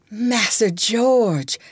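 put-on high, slow, weak old voice; feeble voice. A narrator's reading. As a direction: barely get the words out, dying. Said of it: "speaking in a feeble voice".

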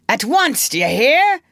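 high, sharp captain's order; commanding cry. A narrator's reading. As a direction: demand instant obedience as the captain. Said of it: "cried Peter".

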